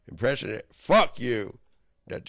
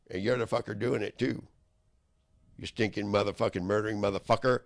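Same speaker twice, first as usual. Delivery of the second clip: ordinary talk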